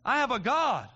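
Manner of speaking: loud, high impassioned preaching climax; preaching at full force. Real, not acted. Preacher rousing the congregation about the Savior, full-force preaching. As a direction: build it to a shouting crescendo.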